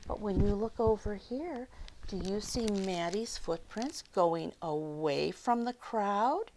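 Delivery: usual speaking voice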